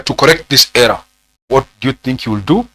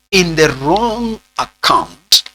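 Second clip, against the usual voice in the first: very slow, higher, words stretched out; slow dictation; 4 semitones higher, much slower than usual. Real, not acted. Teacher dictating the key words of a definition, very slow dictation. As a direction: draw out "wrong account" for note-takers.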